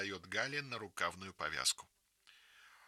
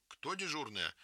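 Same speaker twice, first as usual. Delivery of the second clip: indignant; 6 semitones higher than usual. A narrator's reading. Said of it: "вскрикивает Галя возмущённо".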